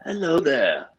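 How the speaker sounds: a light Alec Guinness Obi-Wan; Alec Guinness impression. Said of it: "It's more like".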